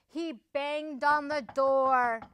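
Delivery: ordinary delivery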